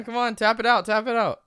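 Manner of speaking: high, loud coaxing shout; loud urging. Real, not acted. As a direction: egg her on loudly. Urging her to pour sauce.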